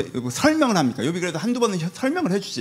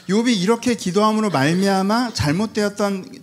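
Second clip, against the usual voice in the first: ordinary talk, nothing marked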